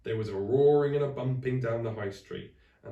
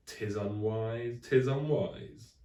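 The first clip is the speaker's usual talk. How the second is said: slow drawn-out solemn warning; ominous warning; much slower than usual. Acted. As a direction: intone it like a prophecy. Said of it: "warned Friday".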